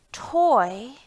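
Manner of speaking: ordinary delivery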